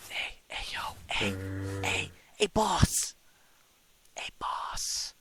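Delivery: sneaky whispered calls. Real, not acted. Player whispering to the boss while sneaking, hushed. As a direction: whisper to get someone's attention.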